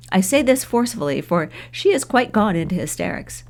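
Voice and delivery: ordinary delivery, nothing marked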